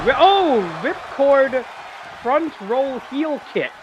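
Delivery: loud high cry, then drawn-out move name; excited play-by-play. Real, not acted. Commentator calling a ripcord heel kick, excited shout. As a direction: burst out, then stretch the call. Live call of a big move.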